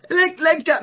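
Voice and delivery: high, pleading call to let go; urgent let go. Retold or imitated. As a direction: beg him sharply to let go. Sponsor calming panicking speaker.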